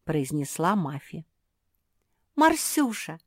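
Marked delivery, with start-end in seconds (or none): 2.4-3.2 high-pitched call of a name. A narrator's reading. Зефирка calling up to Марсия, crying out. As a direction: call up to someone. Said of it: "крикнула Зефирка".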